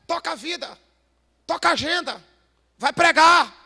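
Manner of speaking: roaring pulpit cry; fiery preaching. Acted. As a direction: preach it hard to the pastors. Preaching at full force.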